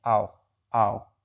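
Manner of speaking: आओ said slowly, gliding from आ to ओ; drawn-out diphthong. Shown as a demonstration. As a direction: stretch आओ so both vowels are heard. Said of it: "ये जो है आओ का आवाज़ देता है". (आओ को धीरे से, आ से ओ तक खींचकर बोलें)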